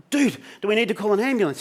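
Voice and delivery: high, hurried question; urgent alarm. Retold or imitated. Troy answering his gasping mate on the phone, urgent alarm.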